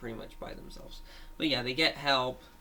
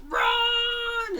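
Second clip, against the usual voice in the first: high, loud cry of Run; shouted warning; 8 dB louder than usual. Acted. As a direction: scream it in panic. Green one yells to flee.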